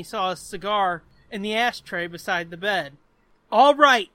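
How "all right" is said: loud, sharp demand. A narrator's reading. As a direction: bark it out as a jealous husband. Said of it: "David demanded".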